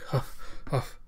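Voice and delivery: short, soft puffs of breath; imitated huffing. Ito stands huffing after his outburst, imitated panting. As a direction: mimic spent panting.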